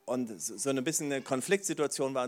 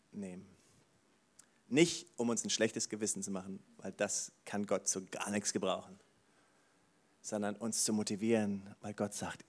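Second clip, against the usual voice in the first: ordinary talk, nothing marked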